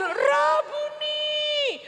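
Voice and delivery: high, very slow drawn-out call; high drawn-out joyful call; joyful recognition. Acted. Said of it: "Aku panggil".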